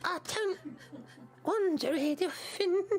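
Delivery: put-on slow, halting, soft old voice; old seer with long pauses; mysterious old grandmother. Grandmother answers the players.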